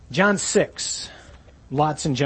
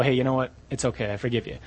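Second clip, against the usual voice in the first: quiet offhand "I forgive you"; calm shrug of a forgiveness; soft, casual forgiving; 5 semitones lower, 5 dB quieter, faster than usual. Retold or imitated. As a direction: let it go gently, softer and lower. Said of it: "I look at him and I go".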